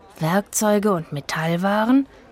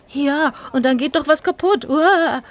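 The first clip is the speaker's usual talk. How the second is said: scared; 8 semitones higher, 3 dB louder than usual. Acted. Rufus frightened by breaking things.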